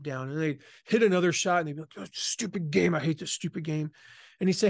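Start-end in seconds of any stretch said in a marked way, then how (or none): 2.0-3.9 understated acted annoyance; mock frustration. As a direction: grumble like a fed-up golfer. Retold frustrated golfers slamming clubs.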